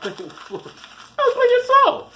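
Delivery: very high, drawn-out silly voice; mock soul-cleanser. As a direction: squeal it like a hammed-up preacher, laughing. Mock preacher offering bleach, laughing.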